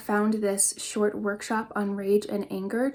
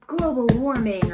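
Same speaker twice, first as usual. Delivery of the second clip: forceful yell; 2 semitones higher, 8 dB louder than usual. Shown as a demonstration. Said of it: "it would be like".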